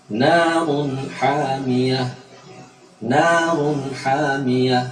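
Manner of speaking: Narun hamiyah very slowly, long vowels, clear n before h; clear izhar. Shown as a demonstration. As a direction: chant Narun with a distinct n, then hamiyah. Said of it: "diperjelas cara mengucapannya".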